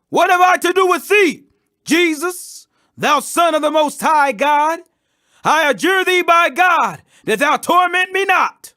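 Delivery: loud, high desperate plea; loud cry. A narrator's reading. Possessed man crying out to Jesus, loud and high. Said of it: "cried with a loud voice".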